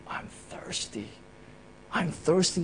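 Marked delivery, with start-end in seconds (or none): 0.1-1.1 faint breathy whisper, almost no voice; whispered cry. Retold or imitated. Preacher voicing Jesus on the cross, a faint whisper. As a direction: whisper it like a dying man's last plea. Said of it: "And he cried out".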